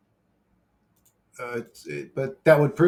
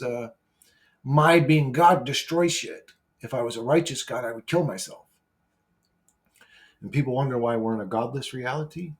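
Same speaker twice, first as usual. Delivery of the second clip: ordinary talk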